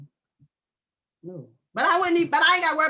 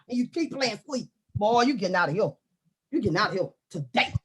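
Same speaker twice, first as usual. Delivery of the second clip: usual speaking voice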